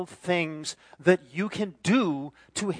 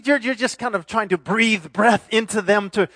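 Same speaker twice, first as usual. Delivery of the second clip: ordinary talk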